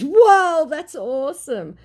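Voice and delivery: hammed-up fake amazement; mock impressed scammer. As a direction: gush like a phony admirer. Scammer's reply voiced.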